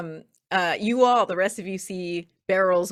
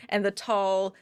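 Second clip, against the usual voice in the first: ordinary talk, nothing marked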